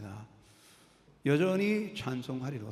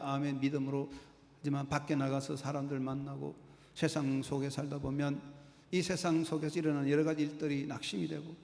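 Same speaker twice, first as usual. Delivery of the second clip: ordinary talk, nothing marked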